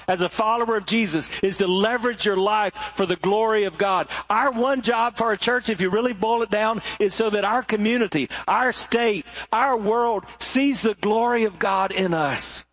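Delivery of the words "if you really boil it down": ordinary talk, nothing marked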